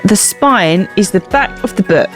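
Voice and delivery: usual speaking voice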